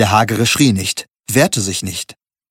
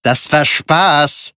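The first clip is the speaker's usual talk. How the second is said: slow, higher barked line; barking; 5 semitones higher, much slower than usual. A narrator's reading. Thug barks at the narrator, slow and menacing. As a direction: bark each word out, menacing. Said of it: "bellte er".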